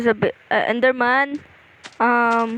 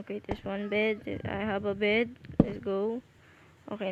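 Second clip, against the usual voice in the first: softer, hushed voice for bedtime; quiet bedtime talk; 8 dB quieter than usual. Real, not acted. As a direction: keep it down, it's getting late. Bedtime, it's getting late.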